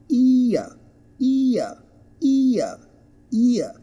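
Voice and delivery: high, falling "ear", ee sliding to uh; two-sound diphthong. Shown as a demonstration. Teacher models the word, "ear" in two sounds. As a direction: say "ear" as ee-uh, dropping the r. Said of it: "Listen to the two sounds".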